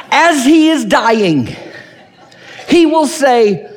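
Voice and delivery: high, rising preacher's declamation; hammed-up, drawn-out proclamation; mock triumphant preaching. Preacher parodies the false comfort in this verse, mock triumphant. Mocking the wrong understanding.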